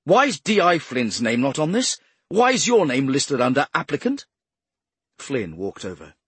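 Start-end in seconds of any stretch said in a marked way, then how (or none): 0.0-4.3 loud, demanding questions; angry. A narrator's reading. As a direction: fire the questions angrily. Angry superior interrogating.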